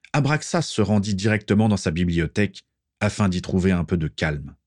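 usual speaking voice

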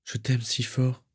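soft, slow loving murmur; tender farewell. A narrator's reading. Mother parts from her daughter, tender and soft. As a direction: let the love out gently and slowly. Mother smiles through tears, parting.

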